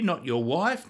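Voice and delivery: usual speaking voice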